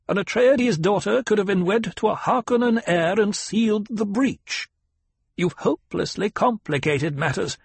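a demanding elder questioning her pupil; old Reverend Mother. Acted. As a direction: severe old matriarch.

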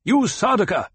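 high, loud challenge; calling out. A narrator's reading. Paul hails the remaining enemy soldiers, loud call. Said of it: "Paul called the remaining group".

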